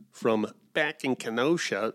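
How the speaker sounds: slow, drawn-out Midwestern attempt at the phrase; Midwestern accent. Mocking his Wisconsin relatives' phrase in a light Midwestern accent. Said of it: "I can't do a Midwestern accent".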